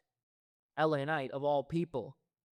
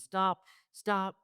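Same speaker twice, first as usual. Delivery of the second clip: higher, louder double stop; exasperated shout; 6 semitones higher, 5 dB louder than usual. Real, not acted. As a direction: cut him off, stop, stop. Ranting, begging Paul to stop.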